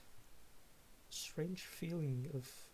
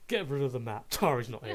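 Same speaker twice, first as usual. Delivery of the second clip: loud frustrated outburst; loud and annoyed; 11 dB louder than usual. Pretend. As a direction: bark it out angrily. GM voices angry character.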